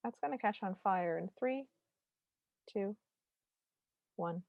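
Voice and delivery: soft countdown to disaster; hushed, knowing countdown with gaps; expecting disaster. Retold or imitated. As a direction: quietly count down to the crash. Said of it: "gonna crash and burn".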